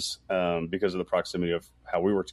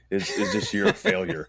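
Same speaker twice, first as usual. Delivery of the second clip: mock reporter question; 8 semitones higher than usual. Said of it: "questions they get asked by media".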